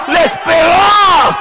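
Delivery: drawn-out high cry of the word l'espérance; l'espérance held long and high, slow; climactic shout. Acted. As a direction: stretch l'espérance into a soaring preacher's peak. Climax of the sermon chant.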